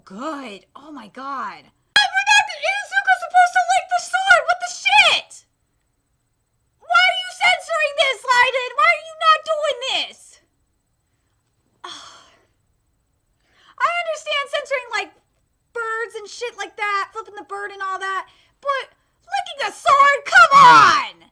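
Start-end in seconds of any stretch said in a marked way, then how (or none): 2.0-10.4 shouting at the studio in disbelief; indignant shout. Real, not acted. Fan yelling at studio over cut scene, high indignant shout. 18.6-21.2 loud exasperated yell; outraged shout. Real, not acted. Fan protesting a censored sword lick, loud exasperated yell.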